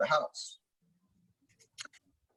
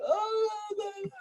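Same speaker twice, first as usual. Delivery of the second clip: mocking kids; 7 dB quieter than usual. Storyteller mimics street kids answering his threat, high mocking jeer. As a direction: imitate jeering kids in a high voice.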